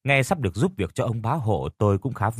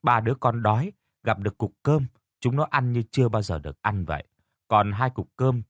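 ordinary delivery, nothing marked

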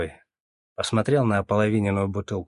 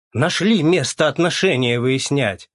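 angry; 5 semitones higher, 6 dB louder than usual. A narrator's reading. Angry queue complaint.

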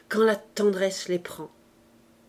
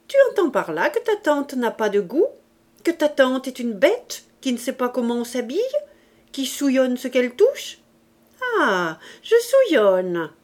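higher, louder, indignant aunt; offended aunt; 5 semitones higher, 6 dB louder than usual. A narrator's reading. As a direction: fire accusing questions as an affronted aunt. (tante vexée et indignée)

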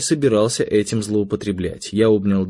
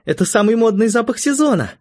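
put-on very high, eager teenage daughter; high-pitched girlish excitement; excited exclamation; 13 semitones higher, 4 dB louder than usual. A narrator's reading. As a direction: claim it at once, thrilled. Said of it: "воскликнула Надя".

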